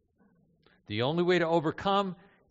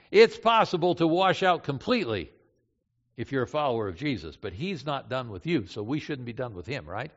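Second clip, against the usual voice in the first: ordinary delivery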